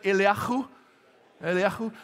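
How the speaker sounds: Eliyahu with a throaty, phlegmy h; phlegmy Hebrew h. Shown as a demonstration. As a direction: demonstrate "Eliyahu" with a rasped kh. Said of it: "supposed to be a bit of phlegm".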